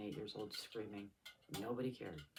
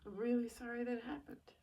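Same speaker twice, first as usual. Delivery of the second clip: voice breaking; 11 semitones higher, 4 dB louder than usual. Real, not acted. Quoting father's apology, overwhelmed.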